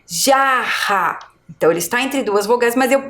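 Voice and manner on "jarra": jarra with a strong H-like RR, drawn out; slow jarra showing the strong RR. Shown as a demonstration. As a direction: lean on the double R in jarra. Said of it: "a minha pronúncia é forte".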